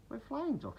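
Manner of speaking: high, light and puzzled; tiny insects caught off guard; surprised. A narrator's reading. Dragonflies answering Duck, high and surprised. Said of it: "said the dragonflies in surprise".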